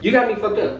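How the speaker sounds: ordinary delivery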